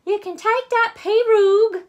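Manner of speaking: high, loud Cajun mama; Mama's voice. Acted. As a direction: call it out like a bossy Cajun mother. Mama instructs her daughter.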